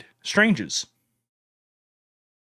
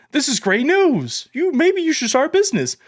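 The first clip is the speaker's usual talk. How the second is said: mock excitement; 12 semitones higher, 4 dB louder than usual. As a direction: sell it like a thrilled salesman. Mock-excited business pitch.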